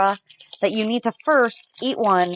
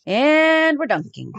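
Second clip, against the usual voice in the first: announcer voice; 5 semitones higher, much slower than usual. Real, not acted. Announces the dunk, drawn out.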